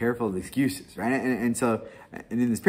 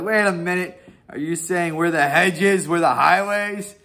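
higher, louder indignant question; mock offended protest; 8 semitones higher, 7 dB louder than usual. Gentiles protesting being called hedges and highways, mock offended. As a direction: play Gentiles realizing they're the hedges. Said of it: "And the Gentiles are like".

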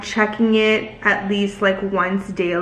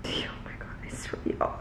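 unvoiced whisper; whispered exasperation; 17 dB quieter than usual. Real, not acted. Vlogger whispering in frustration at ice on the glass table. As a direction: mutter under your breath.

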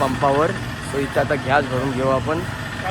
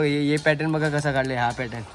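usual speaking voice

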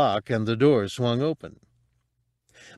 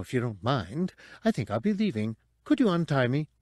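chatty, higher-pitched droid voice; fussy droid; 5 semitones higher than usual. Acted. Captive droid to would-be thieves about its old master, fussy chatter. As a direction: prattle politely like a scandalized droid.

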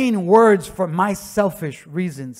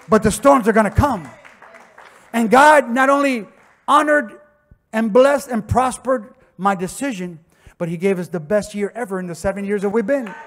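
preaching at full force; 3 semitones higher, 7 dB louder than usual. Acted. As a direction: preach it out to the congregation. Preaching climax.

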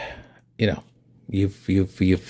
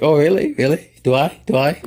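flattered, excited; 7 semitones higher, 5 dB louder than usual. Retold or imitated. Flattered reply to salesperson.

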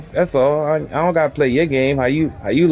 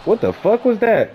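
shocked outburst; 9 semitones higher than usual. Real, not acted. Sudden outburst at a bad play.

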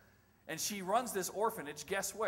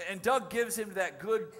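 ordinary talk

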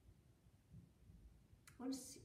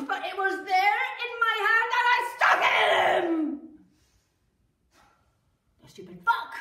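loud, higher, near-shouting rage; furious shout; 4 semitones higher, 13 dB louder than usual. Acted. Wife confessing the stabbing, shouting in rage. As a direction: hurl the confession out in fury.

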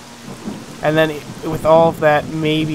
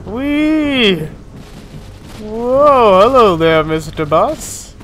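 sing-song tipsy greeting, higher and slow; drunk voice; 5 semitones higher, much slower than usual. Pretend. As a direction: play the giddy drunk. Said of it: "I'm so drunk".

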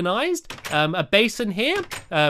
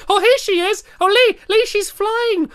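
excited discovery; 14 semitones higher, 5 dB louder than usual. Real, not acted. Spotting the flying Tooth Fairy.

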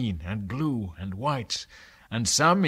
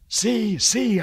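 much higher, louder urgent cry; excited cry; 10 semitones higher, 7 dB louder than usual. Acted. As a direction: point and shout to the crew. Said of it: "I cried".

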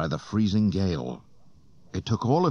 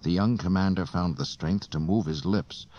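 ordinary delivery, nothing marked

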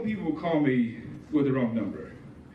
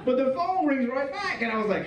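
ordinary delivery

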